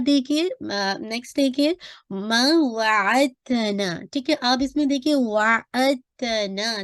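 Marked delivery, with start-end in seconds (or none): 2.8-4.0 वाअतना drawn out slowly with a clear ta sound; slow Quran recitation demo. Shown as a demonstration. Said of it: "ता पढ़ रहे हैं". 5.1-6.8 वाअतना drawn out slowly with a clear ta sound; slow Quran recitation demo. Shown as a demonstration. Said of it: "ता पढ़ रहे हैं, दाल नहीं पढ़ रहे".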